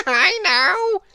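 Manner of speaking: high, loud giggling voice. A narrator's reading. Mister Mischief plotting a prank, giggling high. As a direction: giggle through the words like a naughty imp. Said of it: "giggled Mister Mischief".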